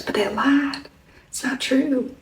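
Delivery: soft whisper of a secret; hushed whisper. Retold or imitated. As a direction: breathe it like a confession. Shopper confiding her complaint.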